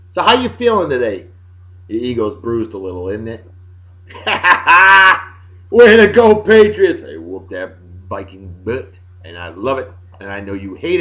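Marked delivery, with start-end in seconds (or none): 4.1-5.3 loud, high burst of gloating laughter. Real, not acted. Gloating over his team's win. 5.7-7.0 loud, high-pitched triumphant shout; gloating cheer. Real, not acted. Gloating cheer for his winning team.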